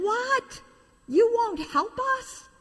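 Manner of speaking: very high indignant cry; shocked toys. Acted. Toys shocked a second time, very high indignant cry. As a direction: squeak out the disbelief.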